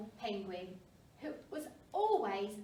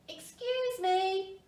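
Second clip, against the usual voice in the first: slow, louder call across the crowd; 8 semitones higher, 7 dB louder, slower than usual. A narrator's reading. Pipkin calls to the huddled penguins, loud and slow. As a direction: call out slowly, loud, to get attention. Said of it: "he called".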